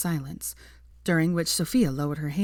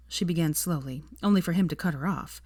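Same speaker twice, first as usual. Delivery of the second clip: ordinary delivery, nothing marked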